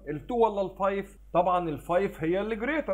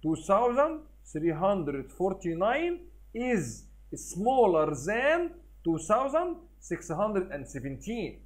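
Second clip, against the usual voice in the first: very slow, careful reading of the comparison; slow read aloud; much slower than usual. Shown as a demonstration. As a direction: model the sentence slowly for pupils. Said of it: "اقول كده".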